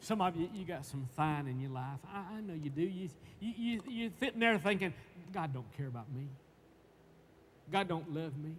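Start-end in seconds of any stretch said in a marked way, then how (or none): 5.3-8.6 mild dejection. Acted. Voicing a doubting listener's thoughts.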